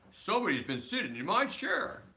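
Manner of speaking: lightly voiced big grumpy bear; great gruff bear voice. Reading a bedtime story to child, gruff papa bear. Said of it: "in his great, rough, gruff voice".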